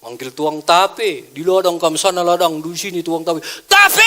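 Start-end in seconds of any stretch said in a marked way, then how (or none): 3.7-4.0 high, loud drawn-out holler; strong call. Shown as a demonstration. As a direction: holler for the tape seller across the fields. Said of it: "Itu kuat".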